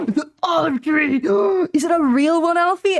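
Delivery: high, loud, breathless delight with gasps; imitating excited gasp. Imitating girlfriend gasping at an olive tree, loud excited squeal. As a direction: gasp and squeal like a thrilled child. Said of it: "never seen anyone that excited".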